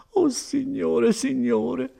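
high, drawn-out wail; tearful, high-pitched lament; almost crying. Acted. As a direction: cry out slowly in despair. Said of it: "quasi piangendo".

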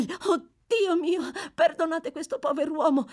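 put-on boy weeping for the condemned man; crying out in tears. A narrator's reading. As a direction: burst into tears as you pray for him. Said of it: "gridò il bambino scoppiando in lacrime".

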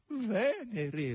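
high, falling lament; whimpered, high-pitched reply; plaintive whine. A narrator's reading. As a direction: moan it mournfully in a high hog's voice. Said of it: "rispose lamentosamente il verro".

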